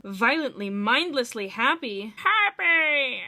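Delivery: rising loud mock mania ending on a yell; building to a shout.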